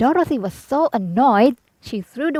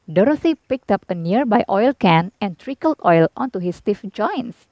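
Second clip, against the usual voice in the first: usual speaking voice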